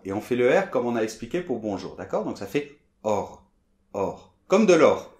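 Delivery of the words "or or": "or" with a throaty French R, like gold. Shown as a demonstration. Teacher showing the first part of au revoir. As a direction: say "or" like the metal. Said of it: "la lettre O et un R".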